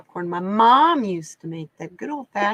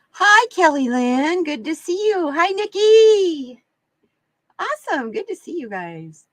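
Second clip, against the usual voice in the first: excited greeting; 8 semitones higher, 7 dB louder than usual. Real, not acted. Greeting viewers in chat.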